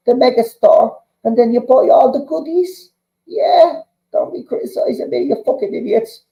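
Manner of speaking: heated rant. Real, not acted. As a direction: rant back at them, voice climbing. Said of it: "ranting at protesting fans".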